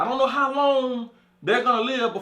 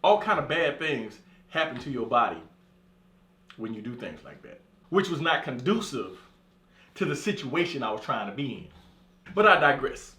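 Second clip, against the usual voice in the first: ordinary talk, nothing marked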